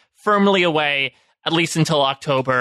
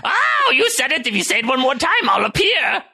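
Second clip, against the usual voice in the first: Crypt Keeper voice; 8 semitones higher than usual. Acted. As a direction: creep it out like a horror host.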